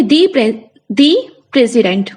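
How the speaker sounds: दी said as "dee", stressed, before president; teacher shows stressed दी before president; "dee" before president. Shown as a demonstration. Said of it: "तो आप क्या बोलोगे".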